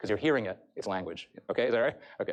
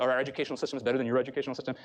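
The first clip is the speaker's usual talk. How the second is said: quick, lightly voiced bragging claim; fast mock boast; rattled-off boast; much faster than usual. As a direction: rush through the smug claim. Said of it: "and people".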